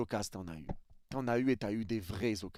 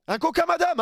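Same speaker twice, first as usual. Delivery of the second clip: mock customer order; 7 dB louder than usual. Plays a snack bar customer.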